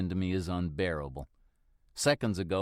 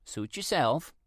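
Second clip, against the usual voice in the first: higher, breezy shrug of a voice; older woman shrugging; 6 semitones higher than usual. Acted. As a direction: let it go lightly. Neighbour voiced.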